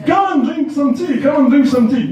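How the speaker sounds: mock merchant hospitality; pushy seller invitation. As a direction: lure him in for tea.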